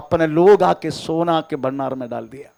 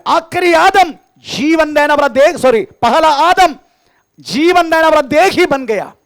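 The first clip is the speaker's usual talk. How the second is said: higher and louder, hammering each phrase to the church; full-force preaching; 12 semitones higher, 8 dB louder than usual. Acted. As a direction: testify it out to the congregation, loud and high. (ऊँची और ज़ोरदार आवाज़ में प्रचार)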